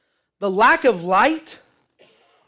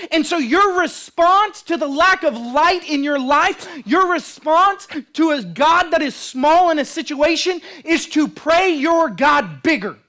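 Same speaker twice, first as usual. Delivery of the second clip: high, driving sermon delivery; impassioned preacher; full-force preaching; 7 semitones higher, 6 dB louder than usual. Real, not acted. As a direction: preach it with rising urgency.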